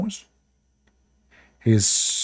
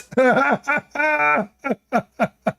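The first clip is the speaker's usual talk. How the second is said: a long, high burst of laughter; 13 semitones higher than usual. Real, not acted. After mocking Sulaiman's tears, a long high laugh. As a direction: laugh helplessly.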